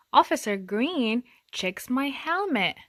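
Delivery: ordinary delivery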